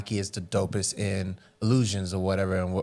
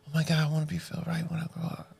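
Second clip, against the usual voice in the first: put-on awed kid whisper; 7 semitones higher, 5 dB quieter, faster than usual. Kid's awed remark retold.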